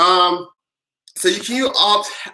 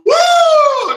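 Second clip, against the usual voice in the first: whoop; 7 dB louder than usual. Real, not acted. Whooping after laughter.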